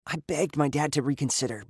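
plain reading voice

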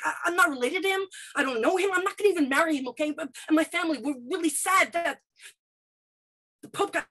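blurting it out before losing nerve; flustered apology. Retold or imitated. Girl apologizing to classmate, high and rushed.

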